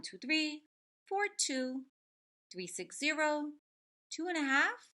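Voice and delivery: lively storybook reading; reading to children. Acted. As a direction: read it out to a class of kids. Reads picture book aloud.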